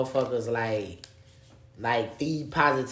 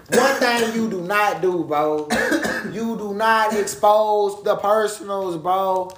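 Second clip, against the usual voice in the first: loud scolding; 6 semitones higher, 6 dB louder, slower than usual. Real, not acted. Scolding about exposing DMs.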